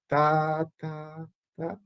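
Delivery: piano "tá", soft but same pitch. Shown as a demonstration. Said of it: "não fazer o piano virar grave". ("tá" piano, mesma altura)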